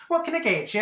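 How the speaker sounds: high, bright-pitched server greeting; sing-song shop assistant greeting; chirpy barista. Retold or imitated. Barista greets Marjorie at counter.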